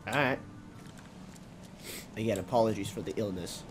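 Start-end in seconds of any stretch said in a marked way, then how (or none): none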